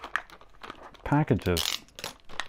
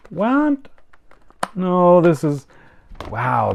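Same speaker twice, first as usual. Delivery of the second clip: much higher, louder startled outcry; real surprise; 9 semitones higher, 5 dB louder, slower than usual. Real, not acted. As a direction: caught off guard by the packaging. Finds yet another blister pack.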